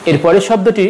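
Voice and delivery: usual speaking voice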